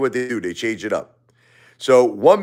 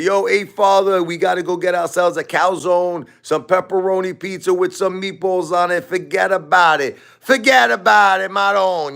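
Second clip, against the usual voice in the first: high, hammed-up Brooklyn Italian guy; Brooklyn Italian impression; 7 semitones higher than usual. Brooklyn John acting nice to the father, in a high hammed-up Brooklyn Italian accent. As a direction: pile on the calzone and meatball bravado. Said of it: "He's gonna".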